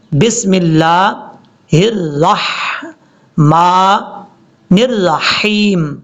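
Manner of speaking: slow, syllable-held 'بسم اللہ الرحمن الرحیم'; correct recitation. Shown as a demonstration. Teacher shows students how to read it, slow correct recitation. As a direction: draw out 'الرحمن الرحیم' with a fine throaty ح. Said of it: "تو ہم نے کیسے پڑھنا ہے".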